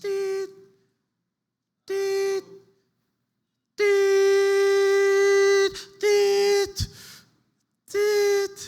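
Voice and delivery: hospital monitor going into a flatline; flatline beep. Pretend. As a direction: imitate the flatline. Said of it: "straight da ang tingog".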